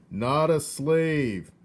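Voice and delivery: loud, slow, high shout. Acted. Soldier shouting to hard-of-hearing Mig. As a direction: bellow each word. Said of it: "shouted the soldier".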